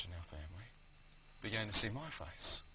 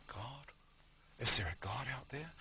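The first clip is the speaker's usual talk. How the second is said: starts near a whisper, rising into a question; hushed wondering; 2 semitones higher, 3 dB quieter than usual. Retold or imitated. Dying man wonders whether God is there, hushed wondering. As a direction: wonder it softly to yourself. Retold doubting thought to himself.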